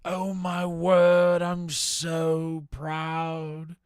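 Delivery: slow, loud, high proud exclamation; drawn-out theatrical swell of pride; gushing pride. Real, not acted. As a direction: stretch every word out in overblown pride.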